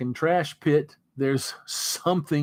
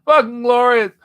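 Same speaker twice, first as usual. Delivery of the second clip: much higher and louder, overjoyed; ecstatic outburst; 11 semitones higher, 10 dB louder than usual. Real, not acted. Streamer delighted at his meal, ecstatic shout. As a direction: gush over the sight of it.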